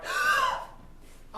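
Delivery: loud falsetto gasp of shock; a scandalized mother's gasp; shocked high gasp. Acted. Mother gasps at son's defiance.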